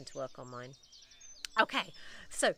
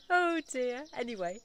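higher and louder, laughing through the words Oh dear; 6 semitones higher, 6 dB louder than usual. Real, not acted. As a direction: chuckle out an amused Oh dear.

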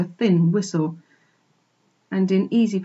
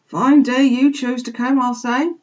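put-on higher, louder rustic old sheep; puffing old sheep; 5 semitones higher, 5 dB louder than usual. A narrator's reading. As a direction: grumble like an old country ewe. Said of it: "puffed the old sheep".

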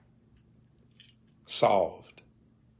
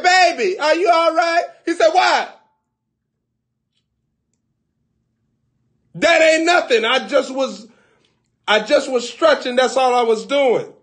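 much higher, loud squeaky voices; high comic voices; 12 semitones higher, 6 dB louder than usual. Acted. Man and woman talking across the house, very high and flustered.

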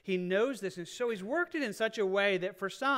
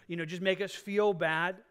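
normal talking voice

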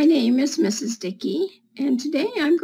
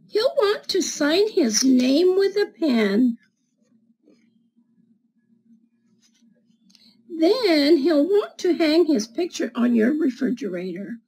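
put-on reading to children. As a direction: read it like storytime for little kids. Reads picture book to children.

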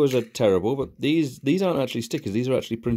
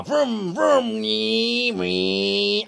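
mock car noises; 10 semitones higher, much slower than usual. Playing with toy car.

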